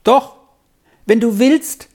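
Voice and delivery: high, lively call; bright-pitched promise called over the shoulder; calling back. A narrator's reading. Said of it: "rief Dora zurück".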